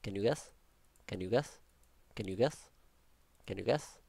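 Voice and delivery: ordinary talk, nothing marked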